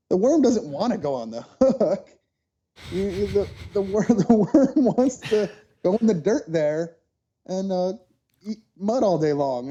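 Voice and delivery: imitating dad's amused disbelief, breaking into laughs; laughing dad. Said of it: "he kinda laughed at me".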